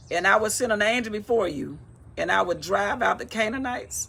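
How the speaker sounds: loud, declaimed Bible reading; scripture reading. Acted. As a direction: proclaim God's command to Moses.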